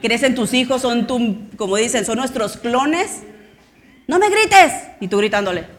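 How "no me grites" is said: high, loud shout of protest; child yelling back. Retold or imitated. Teen shouting back at yelling parent, high loud protest.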